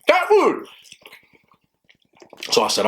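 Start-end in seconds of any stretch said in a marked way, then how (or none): 0.1-0.7 high, incredulous shout of disbelief; exasperated exclamation. Real, not acted. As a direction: blurt it out in disbelief. Exclaims at the pile of cat food.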